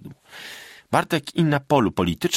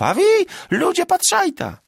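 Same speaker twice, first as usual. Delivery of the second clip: much higher, louder plea; appeal to the crowd; 5 dB louder than usual. A narrator's reading. Magda appealing to the men present, loud plea. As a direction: call the peasants to witness. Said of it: "zwróciła się do chłopów".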